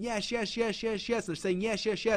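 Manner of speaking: high, eager chorus of yes; eager yeses. Retold or imitated. Crowd of people agreeing eagerly.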